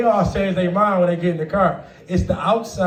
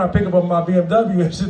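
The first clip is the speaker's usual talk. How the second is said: normal talking voice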